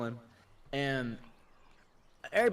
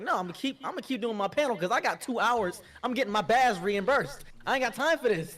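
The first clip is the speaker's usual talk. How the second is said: raised-pitch, brisk refusal; defiant insistence; 6 semitones higher, 3 dB louder than usual. Retold or imitated. Panel host refusing to stop after a fight, defiant. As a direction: insist you are carrying on regardless.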